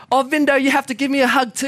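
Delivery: hammed-up jealous younger sister; jealous little sister. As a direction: rush in wanting a hug too. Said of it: "she runs down out of jealousy".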